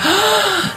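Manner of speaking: bocanada de aire aguda, fingiendo sobresalto; grito ahogado de susto. Retold or imitated. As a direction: toma aire de golpe, como espantado. Relata su susto al verlo. (jadeo agudo de susto imitado)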